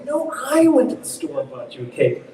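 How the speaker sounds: "I" punched high and loud; contrastive stress on I. Shown as a demonstration. Director answering a question about Tommy, contrastive stress on "I". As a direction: correct them by hitting "I". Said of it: "an antithetical position to Tommy".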